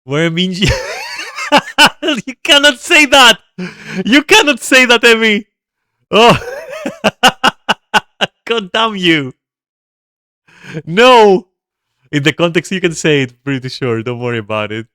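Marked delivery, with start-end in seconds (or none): 0.0-5.5 high, loud, laughing shout; laughing outraged shout. Real, not acted. Streamer shouting at chat member Emmy, laughing and loud. 6.1-11.5 high, loud cackling laugh; loud laughing outburst. Real, not acted. Streamer cursing chat member through laughter, high and loud.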